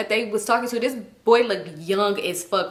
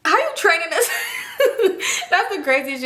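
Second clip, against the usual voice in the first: laughing in disbelief, higher; 10 semitones higher than usual. Real, not acted. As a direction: crack up while asking. Laughing at the absurd excuse.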